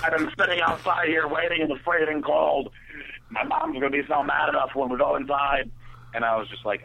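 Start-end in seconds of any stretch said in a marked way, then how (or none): 0.0-5.7 annoyed friend. Retold or imitated. Friend left waiting in the cold, mock angry complaint. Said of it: "And the friend's like".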